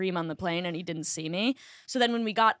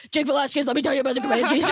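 fast, louder, higher eager pitch with a laugh; eager excited blurt; 4 semitones higher, 4 dB louder, faster than usual. Retold or imitated. Friend at the gate, eager to talk Comanches, excited. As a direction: rattle it off, bursting with excitement. Retelling her eager greeting.

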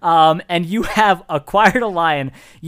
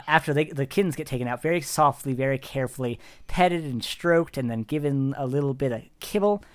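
normal talking voice

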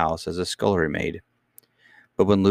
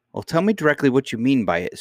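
high-pitched, understated mock scream; a touch of frantic anger; mildly angry scream; 6 semitones higher than usual. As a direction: demand it crossly, pitch raised without yelling. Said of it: "screamed the nurse".

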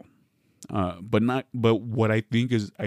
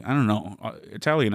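ordinary delivery

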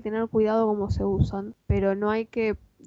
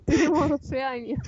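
laughing; 4 semitones higher than usual. Real, not acted. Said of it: "perdón la poca seriedad".

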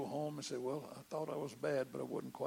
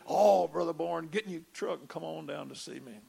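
eager call; 10 dB louder than usual. Retold or imitated. Pastor urging him to come.

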